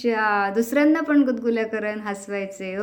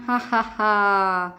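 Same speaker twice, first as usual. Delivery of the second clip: slow hearty laugh; much slower than usual. Pretend. Sharing lassi, laughing.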